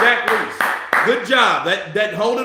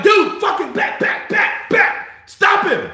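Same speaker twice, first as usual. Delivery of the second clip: frantic shouting; 13 semitones higher, slower than usual. Real, not acted. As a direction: scream at the TV. Yelling at the fighter to attack.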